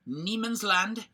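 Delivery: mock German accent on Niemandsland. Shown as a demonstration. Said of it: "you like my German accent?".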